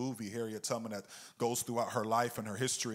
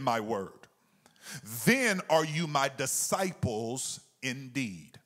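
slow, raised, deliberate reading; slow scripture reading; 4 semitones higher, 4 dB louder, slower than usual. Shown as a demonstration. Pastor rereading the verse slowly for the congregation, deliberate. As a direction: draw out every word of the verse. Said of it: "we're gonna take our time reading this".

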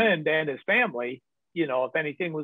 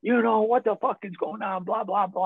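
retold blow-up; 6 semitones higher than usual. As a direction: imitate an exasperated outburst without shouting. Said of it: "he blows up".

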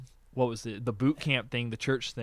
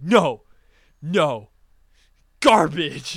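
loud, separate blasts with pauses; mock dismissive verdict; 2 semitones higher, 9 dB louder, much slower than usual. Friend judging the song, mock dismissive verdict. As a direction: imitate a friend pronouncing each word like a ruling.

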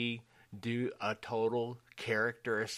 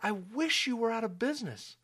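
mock bitter; 12 semitones higher than usual. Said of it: "and I think".

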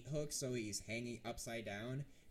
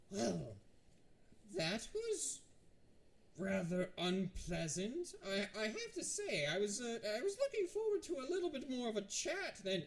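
high, affected villain voice; chatty captive necromancer; 9 semitones higher than usual. Acted. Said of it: "the necromancer just kind of coughs and goes".

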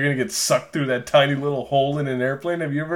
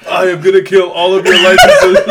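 hammed-up shouted threat, breaking into laughter; loud mock politician; 3 semitones higher, 11 dB louder than usual. Co-host topping the president bit with a loud hammed-up voice. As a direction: bellow it as a comic impression.

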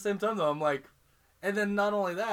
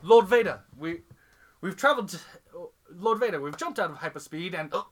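hammed-up British Imperial officer; British accent; 6 dB louder than usual. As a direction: report to your lord in a British accent. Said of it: "it's like British accent".

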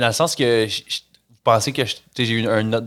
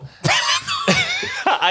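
a high shrieking laugh breaking the take; high burst of laughter. Real, not acted. Host cracking up at his own English, high shriek of laughter. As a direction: crack up mid-sentence.

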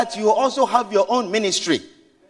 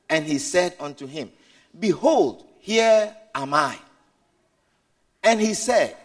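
scripture reading; 8 semitones lower, 3 dB quieter than usual. Acted. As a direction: read it out like holy text. Reads scripture aloud slowly.

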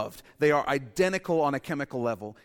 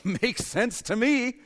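high, slow, smug put-on voice; mock eager agreement; 9 semitones higher, 6 dB louder, slower than usual. Preacher mocking listeners who hear what they want. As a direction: drawl it like a happy convert.

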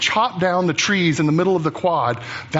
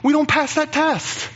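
much higher, squeaky repeat of the admission; high-pitched outcry; 11 semitones higher than usual. Acted. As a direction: cry it out in a high, put-on voice.

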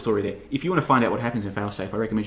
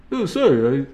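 put-on half-hearted Russian accent, raised and louder; failed Russian accent; 5 semitones higher, 6 dB louder, slower than usual. Boris apologises to the president, poor Russian accent. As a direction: attempt a Russian accent, badly. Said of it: "I can't do Russian accents".

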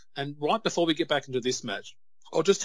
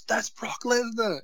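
put-on higher, slow, awed kid voice; excited kid; 10 semitones higher, slower than usual. Dad voicing his son spotting Brock Lesnar, awed and high. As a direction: gasp it out like a thrilled little boy.

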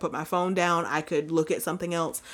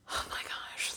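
whisper; 14 dB quieter than usual. Real, not acted. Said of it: "whispered gush over her baby".

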